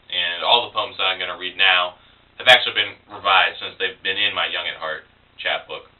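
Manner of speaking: ordinary delivery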